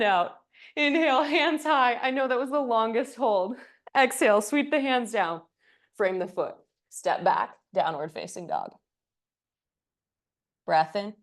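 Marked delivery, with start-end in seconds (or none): none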